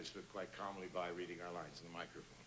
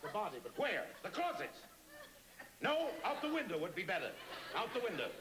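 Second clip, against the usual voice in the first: much higher, urgent, breathless private-eye voice; frantic detective narration. Acted. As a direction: race through the action in rising panic. Frantic radio drama climax.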